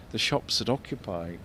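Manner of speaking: usual speaking voice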